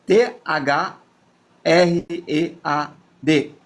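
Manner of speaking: THREAD spelled letter by letter, slow; spelling out letters. Shown as a demonstration. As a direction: spell THREAD out one letter at a time, slowly. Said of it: "O Threads, conhece?".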